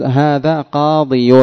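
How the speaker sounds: "هذا قاضي" said slowly, ending in a long ي sound; original form with the ya. Shown as a demonstration. Teacher showing students the underlying form, slow and clear. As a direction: stretch out "قاضي" so the final ي is clearly heard. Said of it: "أصله".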